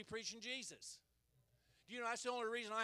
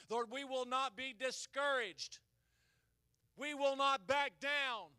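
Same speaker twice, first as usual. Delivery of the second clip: rally speech; 4 semitones higher, 11 dB louder, slower than usual. Acted. Prayer climax.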